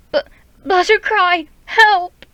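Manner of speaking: high, loud, stammered call for help; panicked plea to her mate; frightened cry for help. Acted. Frightened cat calls for help.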